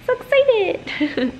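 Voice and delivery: high, slow squeal of excitement; gushing, drawn-out and high; excited. Real, not acted. Girl on her vlog about to watch Love Island, excited squeal.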